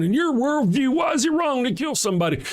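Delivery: high mocking voice; high sneering mock of a debater; mocking apologist. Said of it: "When you say that".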